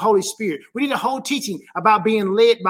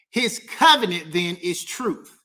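ordinary delivery

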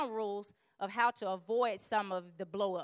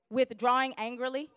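normal talking voice

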